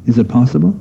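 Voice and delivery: ordinary delivery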